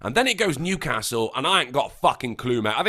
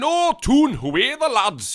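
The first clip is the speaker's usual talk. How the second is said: put-on Geordie accent; 9 semitones higher, 5 dB louder, slower than usual. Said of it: "you go into Newcastle, they start saying".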